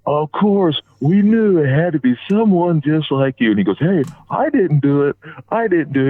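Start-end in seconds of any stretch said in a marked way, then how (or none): 0.1-3.5 drawn-out, higher, teasing "of course"; mock exasperation. Wrestlers ribbing each other as the elevator opens, mock exasperation. 3.8-6.1 higher-pitched playful denial; mock protest. Teased wrestler answering the one in the elevator, mock protest.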